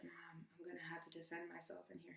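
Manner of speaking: whispered, secretive aside; hushed worry. Retold or imitated. Daughter on jail phone to mom about scary inmate, hushed worry. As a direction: whisper it so nobody nearby hears.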